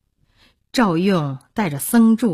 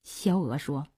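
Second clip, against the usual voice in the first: plain reading voice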